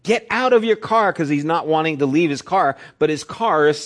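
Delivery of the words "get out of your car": high, pressing shout; urgent call. Retold or imitated. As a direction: tell him to get out, urgently. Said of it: "the cameraman is going".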